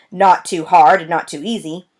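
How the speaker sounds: ordinary delivery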